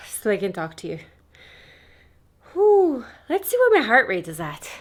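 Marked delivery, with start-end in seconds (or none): 2.5-3.1 breathless blow-out whew; loud exhausted whew. Real, not acted. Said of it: "out of breath".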